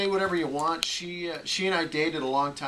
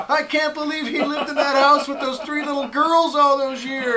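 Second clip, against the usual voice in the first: shocked audience; 11 semitones higher, 10 dB louder than usual. Retold or imitated. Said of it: "five minutes into his set going".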